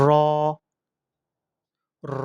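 র rolled, with a long rounded o; correct র. Shown as a demonstration. Teacher says pronounce it this way, drawn-out heavy র. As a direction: roll the r and hold the vowel in র. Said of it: "এভাবে উচ্চারণ".

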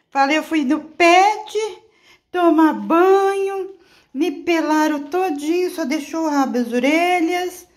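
high, loud dog voice; voicing the dog. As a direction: speak as the dog complaining, slow and squeaky. (dando voz ao cachorro, bem agudo)

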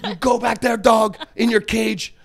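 loud, high mock-chef shout, slowing at the end; imitated yell. Chef sending the young cook back, imitated yell. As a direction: holler the order, drawing out the last words. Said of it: "would yell at me".

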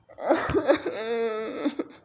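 loud, low drawn-out sob; crying. Real, not acted. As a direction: sob loudly, sinking into a long moan. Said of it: "I'm crying because".

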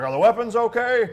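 a high, loud, laughing "weapons okay?"; hamming up an oblivious instructor; laughing mock concern. Mocking instructor's priorities.

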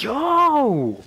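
high, loud, drawn-out yell; shocked hype reaction. Retold or imitated. Fan reacting to savage insults, shocked hype yell. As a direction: holler it in stunned delight.

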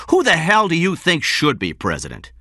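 high, loud, outraged question; angry demand. A narrator's reading. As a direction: demand an answer in a temper. Benjamin keeps shouting at King.

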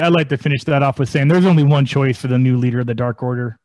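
ordinary talk

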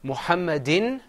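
"Muhammadin" rising at the end; "Muhammadin" as middle item of a list; repeat-after-me model. Shown as a demonstration. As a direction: say "Muhammadin" with a lifted final in. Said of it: "repeat after me".

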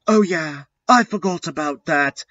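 high put-on character voice; teacher remembering. A narrator's reading. Sinister suddenly remembers.

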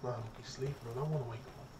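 soft hushed voice. Real, not acted. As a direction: keep it down so he sleeps. Pal sleeping, doesn't want to wake.

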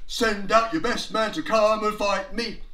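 loud, boastful giant's challenge; Goliath. Acted. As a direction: boom it out to a whole army. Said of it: "And Goliath said".

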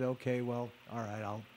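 low, soft grudging consent; reluctant agreement. Retold or imitated. Old lady finally agreeing to go, reluctant. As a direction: give in quietly, dragging the heels. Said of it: "very reluctantly, she finally said".

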